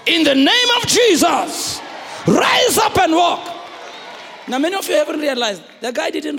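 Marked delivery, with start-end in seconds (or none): 0.1-3.5 slow, loud commanding cry; commanding shout. Acted. Peter commanding the lame man to rise, slow loud shout. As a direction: proclaim it like Peter healing the lame.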